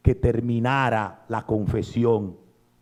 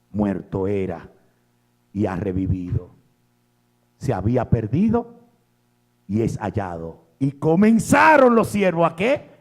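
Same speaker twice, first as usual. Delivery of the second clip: normal talking voice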